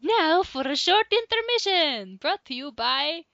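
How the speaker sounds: high, loud announcer. Pretend. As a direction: announce it like a radio host. Announcing an intermission.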